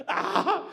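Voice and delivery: loud, high comic scream of alarm; mock panicked yell. Panic at progress bar going wrong.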